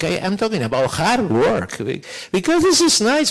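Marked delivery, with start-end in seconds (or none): 2.3-3.3 excited. Real, not acted. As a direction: pitch leaps up, louder, delighted. Enthusing about virtual exchange.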